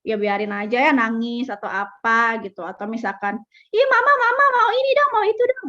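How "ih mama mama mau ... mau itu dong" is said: put-on high, whiny little child begging mama; much higher childish whine asking for this and that; whiny toddler. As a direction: beg like a spoilt toddler tugging a sleeve. Toddler whining for things.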